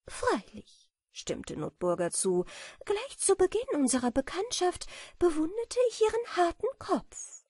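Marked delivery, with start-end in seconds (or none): 0.1-0.9 high, eager butterfly agreement; a little butterfly admiring; lightly voiced high butterfly. A narrator's reading. 2.8-7.4 put-on high, light, dreamy girlish voice; a naive little butterfly imagining honey; sweet and high; innocent butterfly. A narrator's reading. Butterfly admires beetle.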